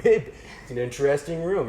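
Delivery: slow, clumsy mock-American drawl; awkward put-on American accent; terrible American accent. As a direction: fake the American, badly. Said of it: "in this really terrible American accent".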